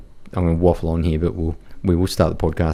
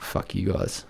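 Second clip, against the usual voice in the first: muttered thought; 12 dB quieter, slower than usual. Retold or imitated. Inner thought at hostile crowd.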